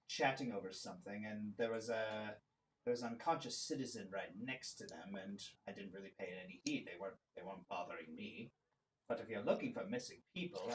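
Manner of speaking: low, hushed tavern keeper; a hint of a gruff barkeep passing a tip; low confiding barman; lightly voiced bartender. Bartender NPC shares a tip.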